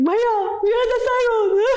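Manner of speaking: high, wailing plea to the driver; calling to a rickshaw driver while crying, high wail; mock crying. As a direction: sob it out like a drama queen. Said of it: "I'd be like".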